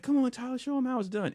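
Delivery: high-pitched pleading imitation of the guys; egging him on. Guys pressing Tyler to show them, egging on.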